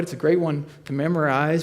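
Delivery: usual speaking voice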